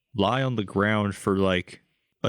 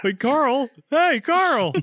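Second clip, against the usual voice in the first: mock cheery greeting; 14 semitones higher, 4 dB louder, much slower than usual. Greeting Carl in a voice.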